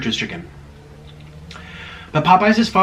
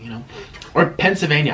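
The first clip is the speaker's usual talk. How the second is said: ordinary delivery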